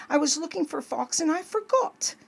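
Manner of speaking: mildly whimpered, high-pitched excuse; sniffling. A narrator's reading. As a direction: plead like a tearful little child. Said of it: "sniffled Franklin".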